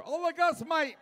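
a hint of a cranky old-timer; old Roger. Comic mocking Roger in the crowd, lightly voiced old man. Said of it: "Look at Roger".

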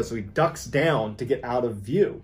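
ordinary talk

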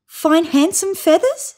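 high, slow hopeful question. A narrator's reading. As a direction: ask it full of hope. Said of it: "said the bunyip hopefully".

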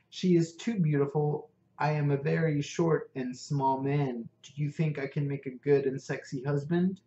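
ordinary delivery, nothing marked

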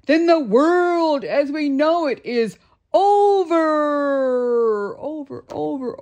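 high, loud, slow wail that fades on each over; despairing wail. Acted. Krabs despairs to the reporter, high and drawn out. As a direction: wail it like the world is ending. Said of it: "cried Mister Krabs".

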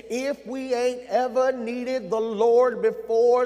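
put-on slow, lightly intoned old church saying; old saints' saying. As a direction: echo the saints of old, drawn out. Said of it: "The saints of old would say".

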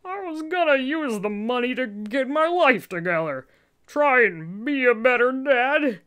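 high, loud, pleading father voice; desperate father. Acted. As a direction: beg your child not to leave.

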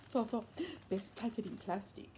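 usual speaking voice

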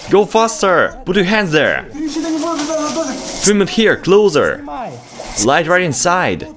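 high, pressing calls to hurry up; urgent high calls to move out. Real, not acted. Hurrying friends out of radiation.

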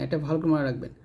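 ordinary delivery

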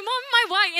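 put-on loud high kid asking mom; childish question. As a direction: ask like a naive little girl. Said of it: "I was like".

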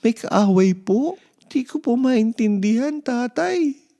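put-on high, loud startled child's voice; a boy caught off guard, voice jumping up; startled and confused; surprised child. A narrator's reading. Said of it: "Nagulat si Mark sa narinig".

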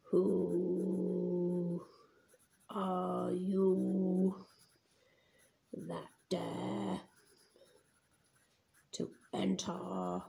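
very slow, long held vowels; slow, sustained writing-along voice; drawn-out dictation. Real, not acted. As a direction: stretch each word out while writing it. Writes hag's line while voicing it.

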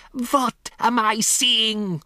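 high, slow, forceful repeat; imperious accented cry, falling at the end; demanding witch cry. A narrator's reading. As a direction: hammer out each word for an answer. Witch repeats question, demanding answer.

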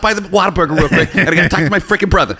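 rally the guys, then make excuses, half laughing; Mark Wahlberg. Acted. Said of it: "Do you think Mark Wahlberg's".

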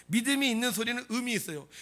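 ordinary delivery

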